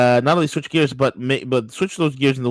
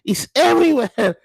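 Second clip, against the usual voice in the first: disgusted fisherman; 5 semitones higher than usual. Retold or imitated. Host imitating a fisherman flinging oil, disgusted and higher. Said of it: "you see the guy picking up the oil and flinging".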